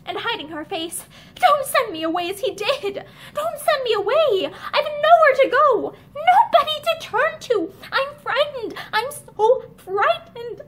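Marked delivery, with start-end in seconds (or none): 1.4-10.6 higher, louder desperate begging; cling and beg in terror; panicked pleading. A narrator's reading. Linda at Barbara's feet, terrified pleading. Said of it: "Her voice changed suddenly".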